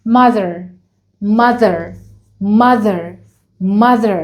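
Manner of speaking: mother with a clear th, tongue between the teeth. Shown as a demonstration. Teacher modelling the th in mother for children. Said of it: "برضو لازم أطلع لساني".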